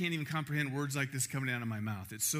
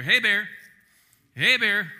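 loud call into the woods; 5 semitones higher, 13 dB louder than usual. Retold or imitated. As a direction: shout to warn off bears. Calling out to bears hiking.

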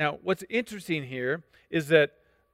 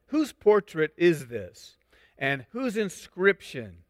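pointed slow question; slower than usual. Acted. Jesus questioning slowly.

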